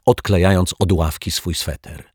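plain reading voice

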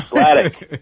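drawn-out, mysterious reveal of the name Sladek; mysterious stage name. Retold or imitated. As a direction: say Sladek like a magician's billing. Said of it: "much more mysterious".

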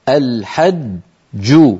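very slow, الحج with a doubled ج bouncing at the end; stop demo. Shown as a demonstration. Teacher showing the stop on الحج. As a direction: stretch الحج, hold the first ج and release the second. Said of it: "تتألف من الحاء".

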